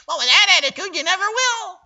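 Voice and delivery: high, loud mocking copy of her voice; mimicking her tone. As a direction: throw her own tone back at her. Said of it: "mimicked her exact tone of voice".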